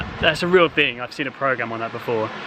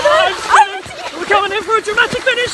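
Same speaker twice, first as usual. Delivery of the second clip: high, fast race-finish call; dramatic sports commentary; 5 dB louder than usual. Acted.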